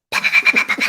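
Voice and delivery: rapid unvoiced pops; pop-pop-pop sound effect with the lips; imitated popping. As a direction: mimic distant firecracker-like pops. Imitating the gunfire noise heard.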